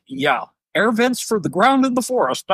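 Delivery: high, mock-obvious remark about the air vents; a knowing scoff at vents in the forest; sarcastic aside. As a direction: say it like it's plain as day. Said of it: "I'm like".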